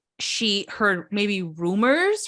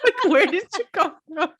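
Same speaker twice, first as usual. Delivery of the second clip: higher, louder mock question broken by laughter; laughing retold quote; 9 semitones higher, 4 dB louder than usual. Host retells Marge asking who are you, laughing incredulously. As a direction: crack up while imitating an incredulous put-down.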